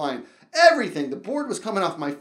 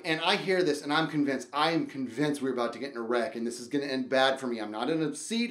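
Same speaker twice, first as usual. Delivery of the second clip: ordinary talk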